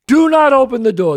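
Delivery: stern street-smart warning; emphatic warning. Real, not acted. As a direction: Do not open the door, loud and firm.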